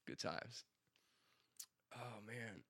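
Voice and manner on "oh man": normal talking voice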